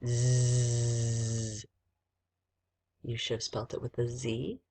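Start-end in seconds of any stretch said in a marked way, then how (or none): 0.1-1.6 "Z" drawn out as a buzzing zzz; long sustained "Z" sound; isolated letter sound. Shown as a demonstration. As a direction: buzz the "Z" steadily, no letter name. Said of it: "Number one is".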